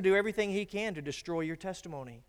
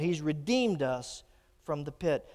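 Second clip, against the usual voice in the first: ordinary delivery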